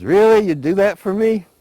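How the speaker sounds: high, loud eager disbelief; acted gushing astonishment, voice way up; delighted surprise. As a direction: exclaim it, thrilled and amazed. Possum delighted by cricket's offer.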